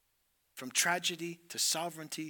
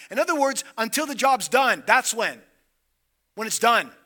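higher, louder climactic declaration; preaching; 6 semitones higher, 15 dB louder than usual. Acted. Preacher answering how long, loud and fervent. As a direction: drive the point home.

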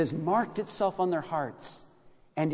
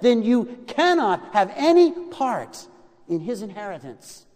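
raised, high preaching voice with punched phrases; forceful preaching; 5 semitones higher, 5 dB louder than usual. Real, not acted. Preacher warning listeners they must be washed by Christ, forceful. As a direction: press the warning home, building in pitch and volume.

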